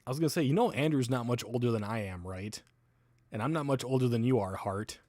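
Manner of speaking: usual speaking voice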